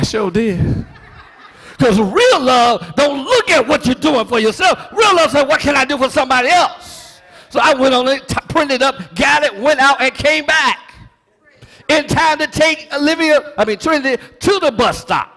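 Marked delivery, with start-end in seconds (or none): 1.8-7.2 loud and high, full preaching fire; preaching shout. Real, not acted. Preacher shouting real love to the church. 7.5-15.3 loud and high, full preaching fire; preaching shout. Real, not acted. Preacher shouting real love to the church.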